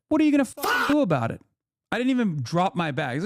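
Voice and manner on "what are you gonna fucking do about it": defiant challenge. Retold or imitated. Retelling how he confronted the filming influencer, a fast high dare. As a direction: snap back, daring him. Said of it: "So I turned around and said".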